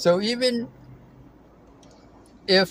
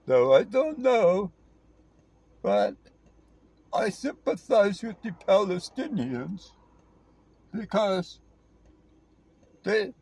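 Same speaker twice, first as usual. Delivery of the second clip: halting, higher-pitched mocking impression; Norm Finkelstein; 5 semitones higher than usual. Mocking a commentator explaining his sympathies, halting high impression. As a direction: go higher and break it up with long pauses. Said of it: "he talks like this".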